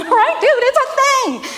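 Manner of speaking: real laugh breaking into high-pitched words; amused, high laughing exclamation. Real, not acted.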